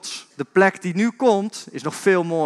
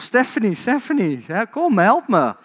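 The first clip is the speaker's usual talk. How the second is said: higher, louder mock call of Stephanie; calling his wife; 6 semitones higher, 3 dB louder than usual. Preacher imitates calling his wife Stephanie for help, lightly acted. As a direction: call across the house for help, half-acted. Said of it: "Dan roep ik toch".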